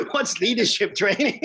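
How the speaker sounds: high, loud mock-clueless question; laughing bewilderment. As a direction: retell your own cluelessness through laughter. Mock-clueless self-quote amid laughter.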